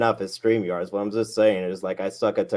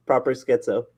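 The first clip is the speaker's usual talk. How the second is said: ordinary talk, nothing marked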